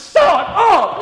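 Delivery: mock outraged shout; exasperated shout. Real, not acted. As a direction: blurt it in disbelief. Exasperated outburst at the story.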